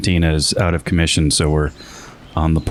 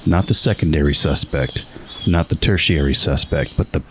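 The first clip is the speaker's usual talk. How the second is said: grand booming space narration; 4 semitones lower than usual. Pretend. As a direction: read it like a movie trailer. Dramatic reading of episode summary.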